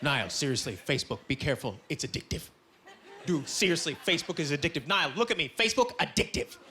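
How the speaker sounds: low, ominous mock-serious voice; grave warning. Friends warning Niall about Facebook, gravely ominous. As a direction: warn as if of a deadly drug.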